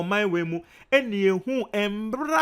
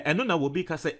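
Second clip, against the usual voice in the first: normal talking voice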